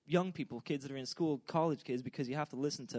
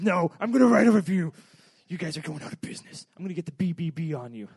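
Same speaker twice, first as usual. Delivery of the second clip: loud, furious customer cutting the clerk off; angry customer; 10 dB louder than usual. Retold or imitated. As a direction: shout down the clerk with threats. Customer shouting threats at clerk.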